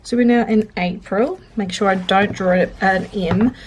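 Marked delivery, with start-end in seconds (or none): none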